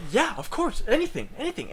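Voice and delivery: high, eager bartender; fawning, happy to serve; eager to please. Retold or imitated.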